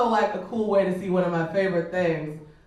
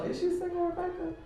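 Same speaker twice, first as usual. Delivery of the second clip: much higher, softer mimic of the man; high mocking voice; 12 semitones higher, 7 dB quieter than usual. Mocking the man who asked if she was single, high squeaky mimicry. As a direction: squeak the question back like a tease.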